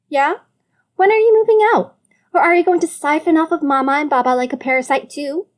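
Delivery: high, fast, loud jab; angry retort. Acted. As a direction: lash back angrily. Sibling quarrel, sister attacks.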